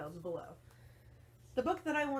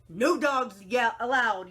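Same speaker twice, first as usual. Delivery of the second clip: very loud angry shout; Hank yelling; 3 semitones higher, 10 dB louder than usual. Acted. Hank shouts at the passing coyote, very loud. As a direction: bellow him off the property. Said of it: "yelled Hank".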